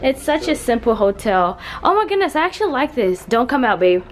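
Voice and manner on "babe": normal talking voice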